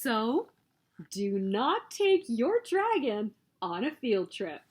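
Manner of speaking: usual speaking voice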